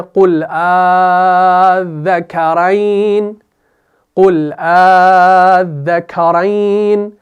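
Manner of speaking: slow, drawn-out Arabic tajweed chant; Quran recitation. Shown as a demonstration. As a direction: recite the verse slowly, stretching each vowel. Recites Quran verse in class.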